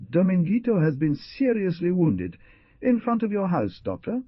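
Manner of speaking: high, loud frightened plea; distraught pleading. A narrator's reading. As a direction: beg the doctor in distress.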